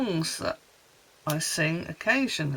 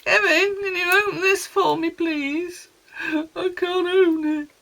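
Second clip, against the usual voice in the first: much higher and louder, a pleading whine; whiny plea for help; 12 semitones higher, 7 dB louder than usual. Retold or imitated. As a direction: beg your husband like a helpless child. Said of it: "go upstairs and ask Kevin".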